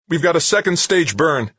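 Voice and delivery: high, loud yelp; yelped alert. Acted. Comtech alerting Wixon to the second stage burn, yelping. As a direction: blurt the alarm out high and loud. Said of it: "the Comtech yelped".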